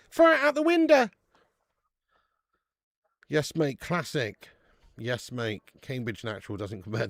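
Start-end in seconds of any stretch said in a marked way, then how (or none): 0.1-1.1 lightly voiced advert catchphrase, much higher; a quick, hammed-up nod to the old milk advert. Host quoting the famous milk advert to chat, mock shouted. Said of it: "famous milk television advert".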